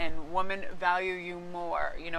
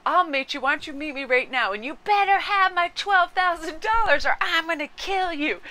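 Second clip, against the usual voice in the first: higher, louder hammed-up threatening yell; mock phone yelling; 9 semitones higher, 5 dB louder than usual. Comedian yelling threats into a stranger's phone. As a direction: bellow a silly threat down the phone. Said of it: "he kept yelling into the phone".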